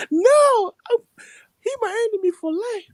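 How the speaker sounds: high mock-crying refusal; crying man. Acting the man refusing to forgive his enemy, mock crying. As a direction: imitate a tearful grudge-holder squealing. Retelling crying man's rant.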